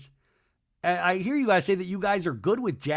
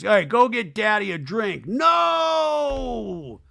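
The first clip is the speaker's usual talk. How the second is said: loud, higher yell ending in a long high "no"; hammed-up drunken shout; 7 semitones higher, 6 dB louder, much slower than usual. [name] lifts his arm for a drink, mock shout. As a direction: bellow it, imitating a rowdy drunk.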